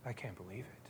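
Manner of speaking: hushed, breathy mutter of disbelief; a Pharisee whispering in shock; whispered disbelief. Retold or imitated. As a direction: whisper it to yourself, scandalized. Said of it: "and he's going".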